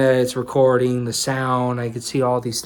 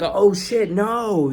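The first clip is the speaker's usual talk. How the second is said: startled oh shit; 7 semitones higher, much slower than usual. Retold or imitated. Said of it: "I did it like".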